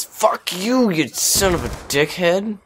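high-pitched burst of cursing; angry outburst. Real, not acted. Gamer swears at an opponent mid-match, angry outburst.

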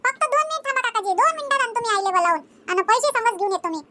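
put-on small boy bargaining innocently; child asking the vendor; curious child. Child runs off home.

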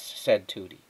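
plain reading voice

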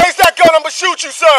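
fast urgent shout. Real, not acted. Officer about to fire.

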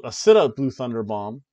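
normal talking voice

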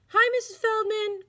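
high, loud greeting called across the room; calling out. A narrator's reading. Boys calling hello to their teacher, loud and high. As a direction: call out hi as you run in.

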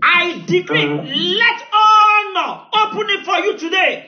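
loud, high, drawn-out decree that swells into song; full-force preaching decree. Acted. Preacher decreeing open doors over his congregation, loud and sung. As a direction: call it down on them like thunder.

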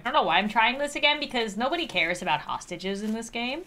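usual speaking voice

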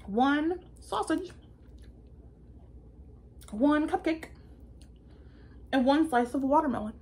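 excited read-aloud of the big feast list; building excitement. Acted. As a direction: pile it on with rising glee. Caterpillar's food list growing.

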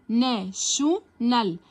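"ناشونال" drawn out slowly with a clear sh; slow careful model. Shown as a demonstration. Teacher shows students that tion sounds like sh, slowly. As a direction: stretch "ناشونال" so the sh sound stands out. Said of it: "بتتنطق شن".

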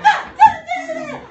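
very loud, high drawn-out yells; frantic mock screams. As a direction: scream in comic panic while beating him. Killers beat him in panic.

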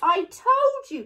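high, loud told-you-so cry. A narrator's reading. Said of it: "cried Eddie".

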